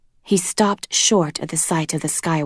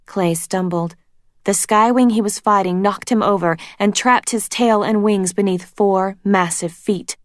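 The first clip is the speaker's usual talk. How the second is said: plain reading voice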